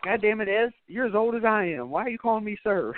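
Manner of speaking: high-pitched exasperated complaint; high, griping buddy voice; exasperated neighbour. Retold or imitated. Neighbour's mock exasperation in retold scene.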